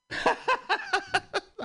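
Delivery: cracks up mid-set and can't hold it; real laugh. Real, not acted. Comedian laughing at his own joke, breaking up. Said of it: "It's hard for me to say it without laughing".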